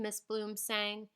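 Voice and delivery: plain reading voice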